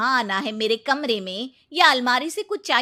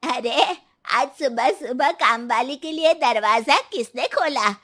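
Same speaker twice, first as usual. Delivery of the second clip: usual speaking voice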